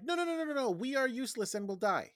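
the stranded pair begging the sergeant, high whiny mock plea; whiny mock pleading. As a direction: plead like helpless dependents, hammed-up. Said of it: "And they're like".

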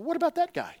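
high, mock-whiny "what about him"; lightly voiced complaining disciple; Peter's question. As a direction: play Peter peeking over at John.

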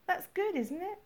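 a hint of a hopeful young woman; Jane's voice. A narrator's reading. Narrator voices Jane, hopeful.

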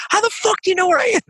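very high, fast, squeaky disbelief; demanding how his friend found him, very high fast shriek; bewildered shriek. Retold or imitated. Voice cracks way up in disbelief. Retold baffled yelling at friend.

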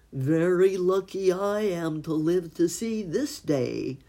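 a proud turkey gobbling out his luck. Acted. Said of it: "gobbled the turkey".

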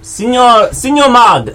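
put-on a light French accent on Signor; lightly put-on European accent addressing Mag. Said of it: "Hello, French".

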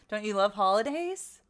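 ordinary delivery

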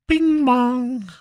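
imitated doorbell. Doorbell announcing delivery arrival.

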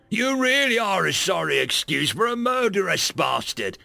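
cold, contemptuous avenger; hooded stranger. Acted. Hooded figure accuses Rotak.

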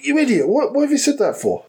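high, exasperated self-scolding. Retold or imitated. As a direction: tell yourself off, high and fed up. Scolding himself in his head.

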